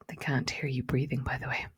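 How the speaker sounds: light conspiratorial whisper with a small laugh; hushed, playful aside. Real, not acted. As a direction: let them in on a secret.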